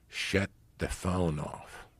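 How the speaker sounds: slow, measured hint of German accent. German passenger ordering him to switch off his phone, German accent. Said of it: "They had a German accent".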